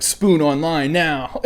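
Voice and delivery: slow barked order; drill instructor command. Retold or imitated.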